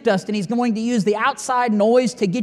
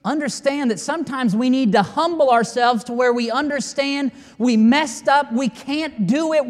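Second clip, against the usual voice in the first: loud, high, fervent preaching; rising pulpit fervour; preaching at full force; 5 semitones higher, 4 dB louder than usual. Acted. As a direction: drive it home to the congregation.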